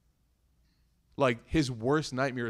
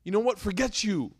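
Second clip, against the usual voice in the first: fed-up retort; 9 semitones higher, 3 dB louder, slower than usual. Retold or imitated. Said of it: "He doesn't say".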